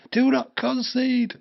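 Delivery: very high, slow yell; pleading yell. Real, not acted. Fan pleading with his team, high yell. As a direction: beg the team not to concede.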